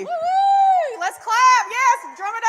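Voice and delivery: high, loud whooping cheer; excited cheering. Real, not acted. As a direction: whoop to rally the crowd. Host cheers a big bid.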